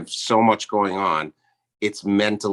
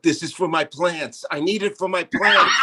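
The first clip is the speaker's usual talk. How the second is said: higher, rising mock madman voice; mock crazy man; 7 semitones higher than usual. Guest imitating host talking to neighbors, higher mock voice. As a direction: play the unhinged neighbor. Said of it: "saying stuff like".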